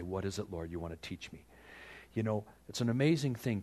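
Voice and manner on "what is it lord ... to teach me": quiet humble prayer. Retold or imitated.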